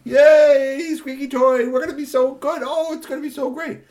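very high, loud cheering voice; family cheering at the robot. Acted. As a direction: cheer like an overjoyed kid.

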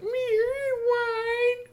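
very high imitated whine; dog whine. Maxie answers Igglypop, imitated high dog whine. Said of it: "'cause dogs whine like that".

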